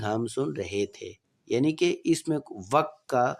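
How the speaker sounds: usual speaking voice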